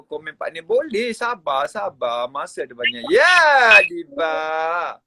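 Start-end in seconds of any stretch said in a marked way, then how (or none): 3.1-5.0 louder, slow call of her name. Real, not acted. Host calls Adibah up to comment, loud and slow.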